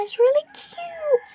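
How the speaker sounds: high-pitched coo over something cute; high, adoring squeal; cute gushing. Real, not acted. Gushing over the cute baby look.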